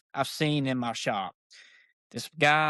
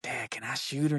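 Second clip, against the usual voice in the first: put-on hushed kid quote; 6 dB quieter than usual. Son asking dad in the hunting blind, hushed. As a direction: ask softly, as if not to spook the doe.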